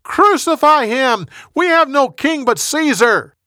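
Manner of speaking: high, loud, slow repeated shouts; insistent crowd chant, pitched high and loud; mob shouting. A narrator's reading. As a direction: bellow it like a crowd on the verge of riot. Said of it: "They shouted louder and louder".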